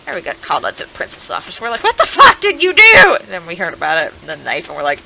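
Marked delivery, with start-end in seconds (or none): 1.8-3.2 loud, high what the fuck; loud high shocked demand; shocked yell. Retold or imitated. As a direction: yell at him in disbelief. Friends confronting him.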